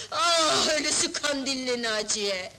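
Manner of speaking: wailing lament. Acted. Wife bewails her love for her husband, wailing lament. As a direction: lament tearfully, building to an anguished cry.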